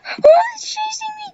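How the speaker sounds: high panicked cry, rushed; scared horror game streamer. Real, not acted. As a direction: yelp it out while fleeing.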